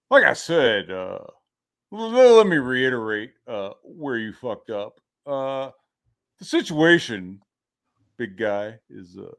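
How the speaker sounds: lightly voiced dad droning through a lecture; halting, long-winded father, trailing off at the end; imitating lecturing dad. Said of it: "he would just start talking, dude, for, like".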